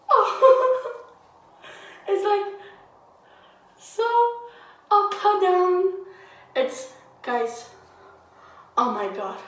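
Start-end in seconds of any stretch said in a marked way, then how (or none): 0.1-5.9 high, giggling through the words; laughing. Real, not acted. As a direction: laugh as you say it. Laughing at mask feeling.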